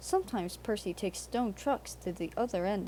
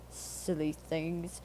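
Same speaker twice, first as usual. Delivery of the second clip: soft, slow scornful snort; 3 semitones lower, 5 dB quieter, much slower than usual. A narrator's reading. Henry sneers at the departed engines, soft and slow. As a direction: dismiss them with contempt. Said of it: "Henry snorted".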